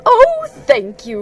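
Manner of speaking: delighted Owl. A narrator's reading. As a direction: gush the oh from high up. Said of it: "said Owl".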